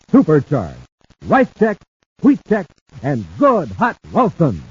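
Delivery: upbeat radio cereal pitch; ad read. Acted. Announcer pitching cereal to the gang, upbeat. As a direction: sell it to the kids with pep.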